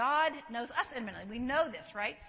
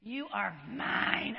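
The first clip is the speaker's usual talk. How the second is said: tender; 3 semitones lower, 4 dB louder, much slower than usual. Acted. Quoting Isaiah forty-three tenderly.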